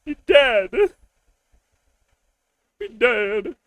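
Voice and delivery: put-on high, slow wailing little girl; childish crying. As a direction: sob it out like a child who just lost her dad. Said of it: "That's how she cries".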